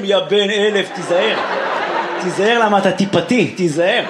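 a lightly voiced low warning; hint of a scolding heckler; mock angry fan. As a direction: growl a fake threat at the comic. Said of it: "היום אנשים".

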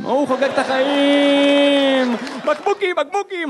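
put-on slow, high, loud gleeful child voice; gleeful kid. Comic voices his son thrilled in the bottle cage, high and gleeful. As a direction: play the toddler delighted by bottles.

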